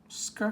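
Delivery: "sk" as one blended sound, not letter names. Shown as a demonstration. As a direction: sound out "sk" as in the start of skate. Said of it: "Does a skateboard start with".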